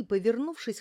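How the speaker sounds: ordinary delivery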